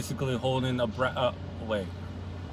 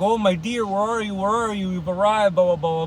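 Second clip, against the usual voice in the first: higher-pitched mock calling for a lover; 7 semitones higher, 3 dB louder than usual. Fyodor calls from the window for Grushenka, calling out. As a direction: imitate an eager man calling for her. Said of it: "starts calling for her".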